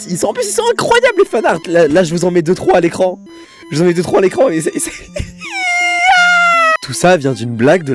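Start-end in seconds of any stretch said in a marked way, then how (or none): 0.1-1.6 excited. Real, not acted. Gushing over fanarts. 5.0-6.8 shrill cracking laugh; high squealing laugh. Real, not acted. Laughing at the joke theory.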